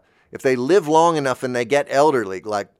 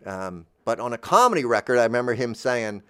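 ordinary delivery

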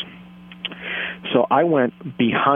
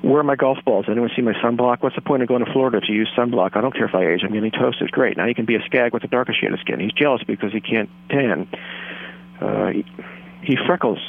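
rapid-fire recited movie lines; fast movie recital; 3 semitones higher than usual. Pretend. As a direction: rattle off the whole script fast. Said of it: "I have to do it fast".

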